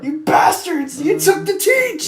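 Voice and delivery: high, drawn-out falsetto wail; mock anguished yell. Podcaster mourning his friend at big tobacco, mock anguished yell. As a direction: cry out in high-pitched mock outrage.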